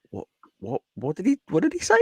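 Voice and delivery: acted-out puzzlement, starting very soft; mock bafflement. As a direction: act lost, as if you caught nothing. Acts out his baffled reaction.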